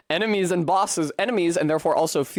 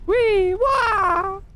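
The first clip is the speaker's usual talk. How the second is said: playful squeal; 4 dB louder than usual. Real, not acted. Playful noise mid-game.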